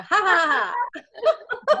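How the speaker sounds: high, loud "ha ha ha"; playful laughing-yoga laugh; demonstrative laugh. Shown as a demonstration. Group member demonstrates laughing yoga to the circle, loud playful laugh.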